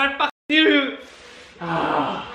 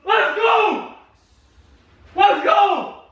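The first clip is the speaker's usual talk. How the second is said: high, shouted cheering; real ecstatic shouts for the team; goal celebration; 8 semitones higher, slower than usual. Real, not acted. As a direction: yell it high like a thrilled fan.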